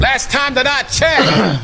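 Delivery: high, slow mocking voice. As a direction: repeat it in a silly high voice. Mock repeat of own line.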